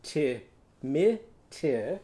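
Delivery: "T-mi-ti" with every vowel as short i; Ichabod sound. Shown as a demonstration.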